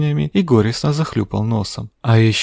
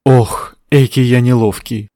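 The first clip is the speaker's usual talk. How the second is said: exclaiming; 4 dB louder than usual. Acted. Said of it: "воскликнул оборванец".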